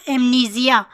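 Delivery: amnesia said emnizija with a z, not an s; z sound in amnesia. Shown as a demonstration. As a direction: amnesia with a buzzing z in the middle. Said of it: "यहाँ एस नहीं, जी pronounce होगा".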